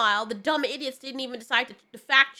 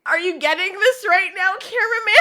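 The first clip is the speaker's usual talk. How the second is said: laughing; 8 semitones higher, 6 dB louder than usual. Retold or imitated. Mocking guy filmed in audience.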